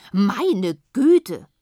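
very loud, emphatic exclamation; exclaimed loudly. A narrator's reading. Frau Philipp finds the moon on her lawn, loud astonished cry. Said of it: "rief Frau Philipp".

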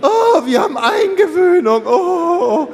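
high-pitched, slow mock gushing; hammed-up proud parent, squeaky and overexcited; kindergarten parent. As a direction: coo it in an exaggerated, drawn-out falsetto. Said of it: "man kennt es ja vom Kindergarten so".

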